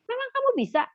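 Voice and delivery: high, incredulous king's question; King Saul doubting David. Acted. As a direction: scoff at the small boy in disbelief.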